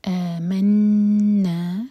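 آمنا with آ stretched two beats, نا held with ghunna; very slow, loud recited آمنا; drawn-out chant; two-beat madd. Shown as a demonstration. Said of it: "نا دو حرکہ کھینچیں".

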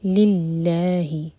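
للہ very slow with a light lam. Shown as a demonstration. Teacher showing the light lam, slow and drawn out. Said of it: "لام جلالہ light".

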